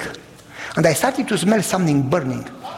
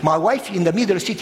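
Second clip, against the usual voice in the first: usual speaking voice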